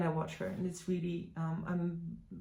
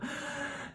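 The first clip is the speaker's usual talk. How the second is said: mock gasp; 9 semitones higher, 6 dB quieter than usual. Mock gasp before sign-off.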